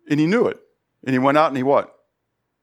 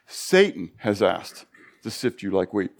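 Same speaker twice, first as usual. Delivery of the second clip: slower, weighty second take; slow emphatic repeat; 7 semitones lower, 4 dB quieter, slower than usual. Shown as a demonstration. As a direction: lean on every word, slowly.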